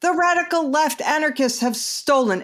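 shouting capitalized words. Shown as a demonstration. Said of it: "I should shout this because it's in all caps".

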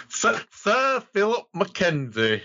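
hammed-up British knight; wrong British accent. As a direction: introduce yourself in a badly faked British accent. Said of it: "go for the wrong British accent".

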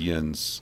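"yuns" said low and soft as one syllable; Pennsylvania dialect word. Shown as a demonstration. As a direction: quote "yuns" the local way, quietly. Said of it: "We said".

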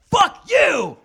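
full-throated screamed curse at the closing door; yelled. Retold or imitated. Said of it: "I just yelled".

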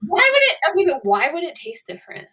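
high, loud, challenging question; incredulous protest. Real, not acted. Arguing back at the co-host.

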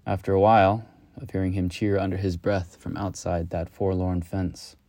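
ordinary delivery, nothing marked

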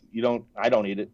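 usual speaking voice

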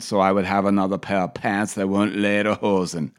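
imitated Austrian accent; Arnold accent. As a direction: keep up the mock Austrian accent. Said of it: "it's hard not to do the accent".

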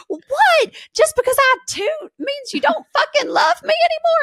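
put-on high, loud thick Tennessee accent. Mom protesting the hug, loud thick Tennessee accent. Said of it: "a thick Tennessee accent".

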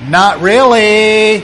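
slow, high, loud drawn-out not really; sulky retort. Pretend. Believer in the tomb refusing redemption, slow and high. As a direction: drag it out in mock refusal.

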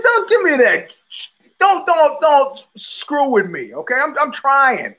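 high-pitched mock exasperation; exasperated retort. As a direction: act out a fed-up protest, voice jumping up. Acted exasperated retort in the story.

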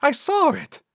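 high-pitched sidekick protest. A narrator's reading. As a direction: insist on it in a very high voice. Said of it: "Cecil protested".

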